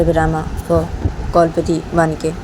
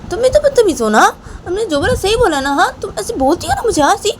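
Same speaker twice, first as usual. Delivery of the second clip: high-pitched angry wife; 11 semitones higher than usual. Retold or imitated. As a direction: press her again and again, voice pitched way up. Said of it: "तो रामा मुझे ऐसे बोलती है".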